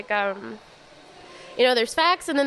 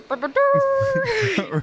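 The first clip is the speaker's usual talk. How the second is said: mock magical boops; 12 semitones higher, much slower than usual. Angel sound effect, laughing.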